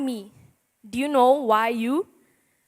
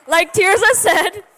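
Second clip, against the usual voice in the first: laughing; 7 semitones higher, 7 dB louder than usual. Real, not acted. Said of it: "laugh heard".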